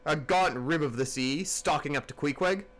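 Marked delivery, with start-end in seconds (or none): none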